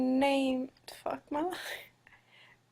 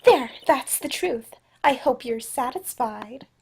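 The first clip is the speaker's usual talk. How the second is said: lightly voiced defiant witness, accent dropped; Olga Orly; 7 dB louder than usual. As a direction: push it out, loud on the first words. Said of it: "Let's give her a different accent then".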